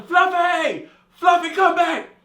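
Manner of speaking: high, loud, slow yell into the cold; naked outside shouting after the lost cat, loud and high. Retold or imitated. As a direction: call the runaway cat at full voice.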